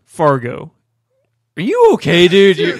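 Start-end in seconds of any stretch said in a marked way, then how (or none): 1.6-2.8 higher, louder alarmed check-in; suddenly worried about a friend; startled concern. Real, not acted. Host startled by his friend's strange noise, alarmed.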